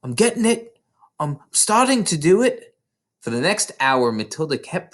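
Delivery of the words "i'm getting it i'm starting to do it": put-on high, thrilled child voice; bubbling with discovery; breathless excitement. Matilda thrilled at her progress, high and excited.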